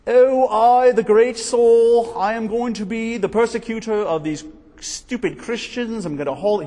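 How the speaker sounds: put-on pompous British accent, stretched out; arrogant Saul, British accent. Preacher mocking proud Saul in a British accent. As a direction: lord it over them in a British accent. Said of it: "I think of him speaking with a British accent".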